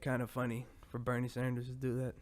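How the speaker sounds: ordinary talk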